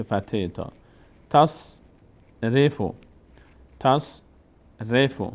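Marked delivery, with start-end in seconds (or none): 1.3-5.2 «تصرف» slowly split as tas, then refu; slow syllabic reading. Shown as a demonstration. Teacher demonstrating silent sad, slow syllables. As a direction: hold the s in تصرف, pause, then finish. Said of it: "یعنی کمک تا باید خوانده بشه".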